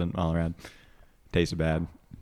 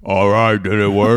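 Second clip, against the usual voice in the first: deep gas voice; 11 dB louder, slower than usual. Real, not acted. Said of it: "I have my own anti-helium gas".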